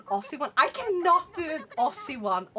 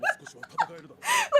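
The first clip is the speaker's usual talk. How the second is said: shrieking laugh, much higher and louder; high burst of laughter; 6 dB louder than usual. Real, not acted. Laughing at chat about Sweden, a high shrieking laugh. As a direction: crack up at the chat joke.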